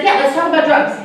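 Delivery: retold teenage eagerness, high and quick to agree; eager agreement. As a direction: jump on the offer like a relieved kid. Retold boy seizing the easier topic.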